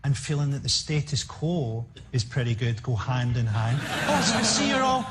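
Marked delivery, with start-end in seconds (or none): 4.1-5.1 high-pitched, loud mock billionaire; lofty billionaire talking down to the hungry; J K Rowling. Comic mocking the rich author looking down on food-bank users, high and loud. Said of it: "Oh, J K Rowling thinks this".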